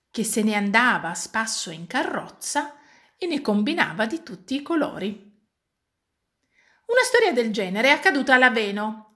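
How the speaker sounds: usual speaking voice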